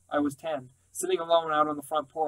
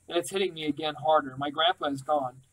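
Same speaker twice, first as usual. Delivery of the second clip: ordinary delivery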